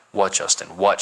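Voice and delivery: ordinary delivery, nothing marked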